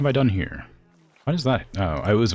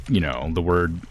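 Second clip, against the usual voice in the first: ordinary delivery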